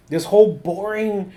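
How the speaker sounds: normal talking voice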